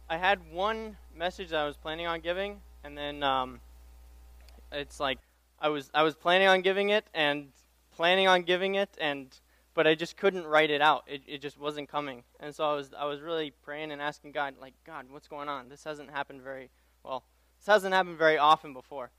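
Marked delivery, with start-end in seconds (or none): none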